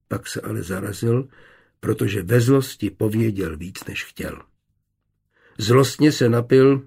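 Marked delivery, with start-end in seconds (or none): none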